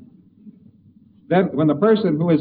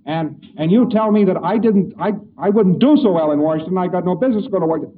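hammed-up rant, higher and louder; rant, offended and griping; an angry man offended at being called unfit for Washington; indignant angry man; 5 semitones higher, 5 dB louder than usual. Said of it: "And the fellow said".

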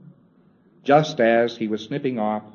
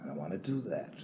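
much softer, reluctant refusal; unwilling; 5 semitones lower, 13 dB quieter, faster than usual. Retold or imitated. The preclear refusing the auditor, softly unwilling. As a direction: mutter it like you won't budge. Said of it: "he's still unwilling to communicate".

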